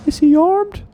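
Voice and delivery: very high, very loud demand; hammed-up cop shout. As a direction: yell the question at her.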